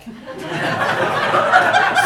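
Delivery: breathy high laugh; real laugh. Real, not acted. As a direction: burst out laughing. Laughing after Steve's offer.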